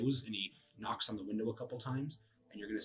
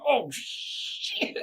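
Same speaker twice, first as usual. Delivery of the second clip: high, loud startled curse; surprised; 8 dB louder than usual. Acted. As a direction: blurt it out in alarm. Acted panic stuck in hole.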